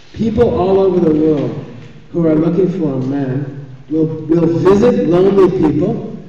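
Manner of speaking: normal talking voice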